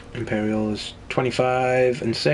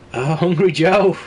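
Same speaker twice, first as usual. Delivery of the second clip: playful raised teasing voice; teasing sing-song; 5 semitones higher, 5 dB louder than usual. Real, not acted. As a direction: tease the greedy hero fondly. Teasing his hungry character.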